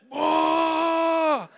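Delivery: long high loud whoa, crying with joy; fired-up whoa. Retold or imitated. Preacher reliving a joyful outburst at work, loud and high. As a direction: cry out overwhelmed with joy. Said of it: "fired up".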